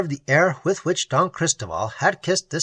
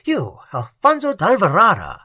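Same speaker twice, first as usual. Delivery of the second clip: louder, higher surprised repeat; amazed at the disguise name; surprise; 4 semitones higher, 5 dB louder than usual. A narrator's reading. Lorenzo amazed at Raymond's false name: higher surprised. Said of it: "in an accent of surprise".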